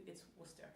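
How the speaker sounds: "Worcester" in two syllables, WUSS-ter, soft; correct local pronunciation. Retold or imitated. Host quietly correcting the announcer, local pronunciation. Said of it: "But could you".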